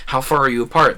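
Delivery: usual speaking voice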